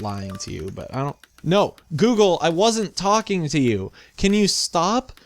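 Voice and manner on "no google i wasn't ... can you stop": loud, high exasperated scolding. Real, not acted. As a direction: tell off the phone, higher and louder. Scolding phone assistant.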